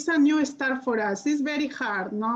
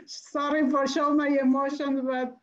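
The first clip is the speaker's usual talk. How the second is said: real tearful apology. Real, not acted. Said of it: "I'm very emotional".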